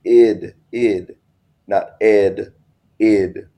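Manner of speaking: id said with a short i sound, never ed; id, not ed, spelled out by sound. Shown as a demonstration. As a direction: contrast id and ed clearly, then id again. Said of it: "I don't know how I'm saying it wrong".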